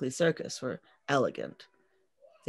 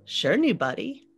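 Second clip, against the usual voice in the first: dumb and cocky; 8 semitones higher, 5 dB louder than usual. A narrator's reading. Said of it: "like the dumb dark girl I was".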